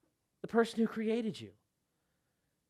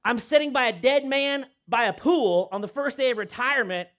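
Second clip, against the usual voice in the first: ordinary delivery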